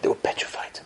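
hushed dread in a whisper; whispered repeat. A narrator's reading. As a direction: breathe it out as a whisper, no voice.

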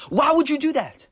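high, loud retold shouting at a kid; angry driver screaming at the boy over a dented car; furious yelling. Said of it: "starts yelling at him at the top of his lungs".

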